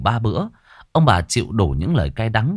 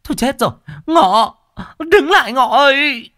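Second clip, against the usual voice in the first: high, loud calling after him; panicked parents calling out; frantic shout; 5 dB louder than usual. A narrator's reading. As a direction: yell for the runaway son. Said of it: "chi hô".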